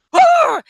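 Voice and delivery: very loud, high roar; one sudden outraged animal roar; angry wolf roar. Retold or imitated. Wolf hating the Elvis song, roaring loudly. It did not like that either.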